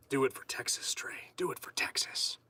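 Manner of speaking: breathy mock whisper of encouragement; half-joking whispered pep talk to Trey. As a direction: whisper it like a sly cheer. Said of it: "I can't even say that with a straight face".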